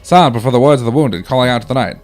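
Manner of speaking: put-on old-timey soldier reading his letter aloud. Hospital patient's letter read out on request, old-timey voice. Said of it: "Give me an old, old-timey".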